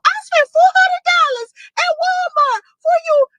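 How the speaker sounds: high mocking whiny voice; squeaky high mock of Mike; mocking quote. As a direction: mimic him in a squeaky high voice. Mocking Mike's claim.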